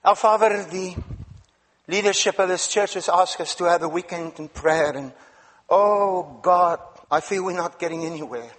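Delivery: low, earnest pastor's prayer; closing prayer. Acted. Preacher leads closing prayer.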